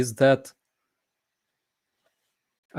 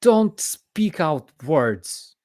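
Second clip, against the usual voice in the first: outraged quote; 9 semitones higher, 8 dB louder, much slower than usual. Retold or imitated. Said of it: "And he's like".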